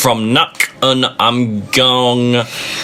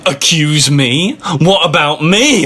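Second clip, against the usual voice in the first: higher and louder indignant snarl; hammed-up jealous anger; mock growl; 6 semitones higher, 3 dB louder than usual. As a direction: throw the accusation back in mock outrage. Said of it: "I growled".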